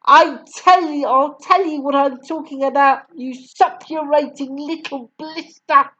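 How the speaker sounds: shouted. A narrator's reading. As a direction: shout him down. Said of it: "the Trunchbull shouted".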